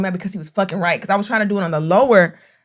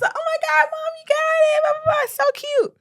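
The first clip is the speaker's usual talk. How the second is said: much higher squeaky excited voice; mock squeal. Daughter gushing to mom about the invitation, high squeal. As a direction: gush like an overexcited daughter. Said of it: "I said".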